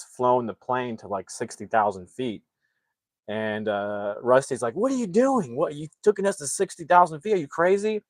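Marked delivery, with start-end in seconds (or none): 4.7-8.0 high-pitched mock alarm, not shouted; incredulous outburst. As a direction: act out a pilot's appalled disbelief. Acted pilot confronts his co-pilot.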